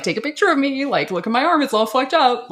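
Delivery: mildly hammed-up excitement; hyped pretend. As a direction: brag about a broken arm like it's fun. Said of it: "I'd be like".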